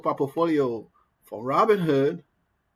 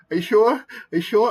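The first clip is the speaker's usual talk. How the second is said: high, loud, anxious repeated question, imitating; panicked pleading; 8 semitones higher, 6 dB louder than usual. Imitating a panicking guy stuck in the elevator, high and loud. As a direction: play the panicking coworker begging for reassurance. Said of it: "he is panicking".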